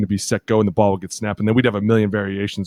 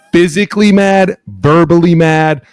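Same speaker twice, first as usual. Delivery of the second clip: loud, heated; 8 semitones higher, 9 dB louder, slower than usual. Real, not acted. Teammate's anger described.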